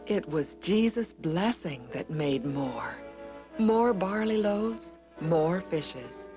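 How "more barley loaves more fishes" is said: usual speaking voice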